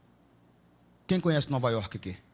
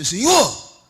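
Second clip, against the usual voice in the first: slow, high pleading; a believer pleading before the Lord; 10 semitones higher, 6 dB louder, slower than usual. Retold or imitated. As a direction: plead your works to the Lord. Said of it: "Aí ele vai falar assim".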